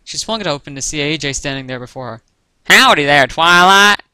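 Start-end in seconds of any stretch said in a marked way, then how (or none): 2.7-4.0 put-on loud country-accent greeting, rising high. A narrator's reading. Applejack greets Twilight at her door, country accent. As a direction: holler a hearty country hello. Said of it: "in her unmistakably country accent".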